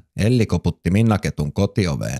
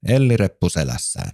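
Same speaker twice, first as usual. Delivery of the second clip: usual speaking voice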